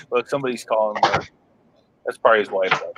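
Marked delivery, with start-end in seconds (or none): none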